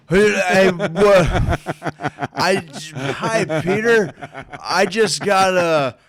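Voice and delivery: high, halting, tongue-tied stammer; garbled. Retold or imitated. Garbled salesman greeting Peter, stammering and high. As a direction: act out words failing, loud and broken. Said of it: "he sees me all garbled up".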